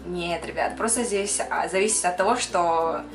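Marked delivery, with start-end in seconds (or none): none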